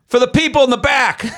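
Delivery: high, projected and laughing; playful calling out. Pretend. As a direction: call to the back row. Asked to repeat a little louder.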